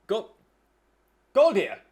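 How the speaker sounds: loud high shout of a name; calling out. Acted. Mistress yelling for her goblin servant. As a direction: yell for the goblin. She calls goblin from behind.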